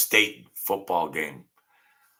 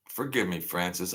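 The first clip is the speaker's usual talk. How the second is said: odd put-on voice; 4 semitones lower, 8 dB quieter than usual. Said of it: "I don't know why I'm talking like that".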